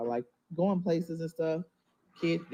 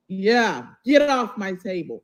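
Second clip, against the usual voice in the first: loud, raised voice at a pet; sharp scolding shout; 3 semitones higher, 10 dB louder, slower than usual. Real, not acted. Mom yells at the pet on her table, loud and sharp.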